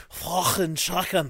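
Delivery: slow, throaty Scouse catchphrase; exaggerated Scouser with scraped k sounds; Scouser impression. Shown as a demonstration. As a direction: do a caricature Scouse accent, drawn out. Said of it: "That's the one when I do a".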